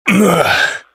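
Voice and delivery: high, short groan. Acted. Rocker right after belting a line, high groan. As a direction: let out a high-pitched grunt of discomfort.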